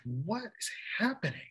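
hushed, high comic astonishment; disbelief. Retold or imitated. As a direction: squeak it out in bewilderment. Retold disbelief again.

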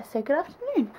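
normal talking voice

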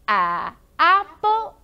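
A, apple said loud and slow; loud, slow phonics restart for the class; loud slow model. Shown as a demonstration. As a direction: belt out A, apple slowly. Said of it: "I want to start again".

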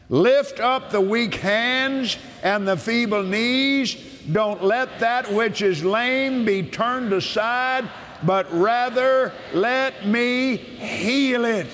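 solemn preacher's proclamation; preaching scripture. Acted. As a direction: declare it as the voice of God himself, slowly.

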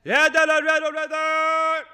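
an actor's vocal warm-up belted high and loud. Shown as a demonstration. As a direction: blast the vowels out to warm up. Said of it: "let me just get into character".

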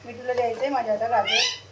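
ordinary talk, nothing marked

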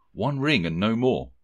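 usual speaking voice

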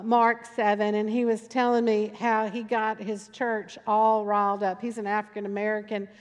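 normal talking voice

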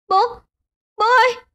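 very high, urgent call; distraught cry. A narrator's reading. Daughter hugging her collapsed father, high distraught cry. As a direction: cry out for your dying father.

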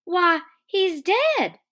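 high, loud, drawn-out cry of shock; acted shocked exclamation. A narrator's reading. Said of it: "she cried".

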